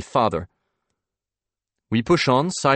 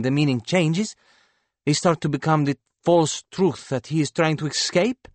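high painter's voice, growing louder and higher; impassioned painter, animated; 7 semitones higher, 4 dB louder than usual. Acted. Painter telling banker why paintings go unsold, impassioned. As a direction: argue a hero's ideas with mounting fervour.

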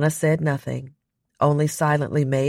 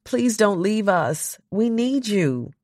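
put-on higher, slow childlike pleading; childlike plea; 8 semitones higher, slower than usual. A narrator's reading. Brooklyn begging Donna not to leave them, slow and childlike. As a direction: beg like a small child left behind. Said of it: "She sounded like a child".

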